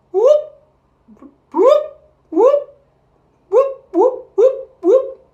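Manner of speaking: very high loud whoops; shrieking animal whoops; zebra bray. Shown as a demonstration. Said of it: "Let's try braying like that zebra".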